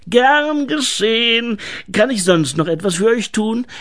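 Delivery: hohe, gedehnte Gnomenstimme; dienstfertiger Gnom. Acted. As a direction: biete dich übertrieben dienstfertig an, in hoher Stimme. Gnom spielt den dienstfertigen Helfer. (übertrieben dienstfertig)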